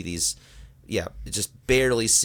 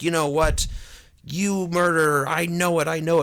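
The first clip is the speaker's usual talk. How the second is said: high, emphatic mock outrage; hammed-up indignant confrontation; acted accusation; 8 semitones higher than usual. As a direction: accuse him in a raised retelling voice. Retold accusation of murder.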